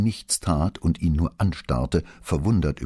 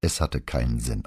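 plain reading voice

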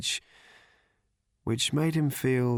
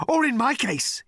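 raving journalist; 14 semitones higher, 4 dB louder than usual. Acted.

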